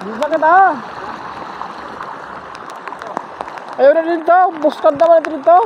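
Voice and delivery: high, excited calls; excited calling out. Real, not acted. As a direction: call out the catch excitedly. Excited at the catch outdoors.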